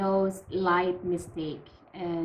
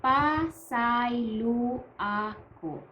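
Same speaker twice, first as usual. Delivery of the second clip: slow, drawn-out "Pasaylo ako", each syllable held; slow demonstration; much slower than usual. Shown as a demonstration. Teacher introduces the formal apology to learners, slow demonstration. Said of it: "the formal way of saying".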